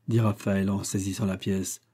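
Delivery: plain reading voice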